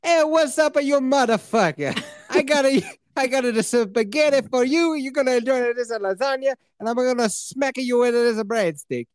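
hammed-up, high-pitched Italian-style "French" accent; supposed French accent. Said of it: "this is how French sounds".

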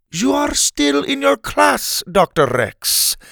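high, slow character voice; stern explanation. Acted. Helga tells dazed Doctor Rex where he is, stern. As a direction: spell it out deliberately for someone dazed.

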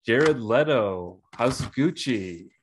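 slow, drawn-out awards reveal; award announcer. Acted. Host announcing the award winner to a co-host. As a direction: announce the winner with a dramatic pause. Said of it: "the bummy for best worst Italian accent goes".

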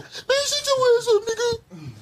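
high, slow mocking squeal. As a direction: sneer it in a high put-on voice. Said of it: "I be like".

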